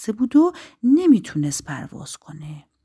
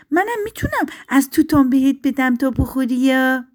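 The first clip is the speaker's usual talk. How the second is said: high, louder small-tree voice; eager little tree; 7 semitones higher, 6 dB louder than usual. Acted. Little juniper offers the bird berries, eagerly.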